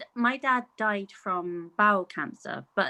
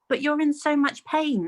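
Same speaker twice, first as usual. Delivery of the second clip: higher-pitched, mildly urgent protest; distressed pleading; 7 semitones higher than usual. Retold or imitated. Daughter to her dad in pain, retold pleading. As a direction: plead with a stubborn parent, acted lightly.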